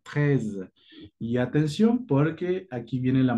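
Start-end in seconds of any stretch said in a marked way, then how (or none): 0.0-0.7 "treize" with the final z dragged a little; French treize. Shown as a demonstration. Teacher modelling treize again, dragging the z. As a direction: stretch the z at the end of "treize". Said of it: "como que arrastras un poquito la Z".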